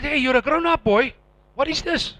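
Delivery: high, loud self-rebuke; self-scolding. Retold or imitated. Scolding himself for weeping, loud and high. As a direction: snap at yourself to stop crying.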